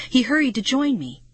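ordinary delivery

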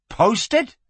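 high, rising incredulous question; startled echo. A narrator's reading. Flynn echoes the sheriff's word, startled. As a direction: echo it in sharp disbelief. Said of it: "echoed Flynn".

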